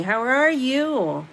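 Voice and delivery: ordinary talk, nothing marked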